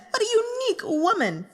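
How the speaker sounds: gushing. Acted. Fan gushing over character.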